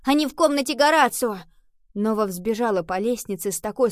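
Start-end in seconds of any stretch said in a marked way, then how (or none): 0.0-1.4 high, loud shouted news; urgent call. A narrator's reading. Henry calls to Nova where the others are, shouting.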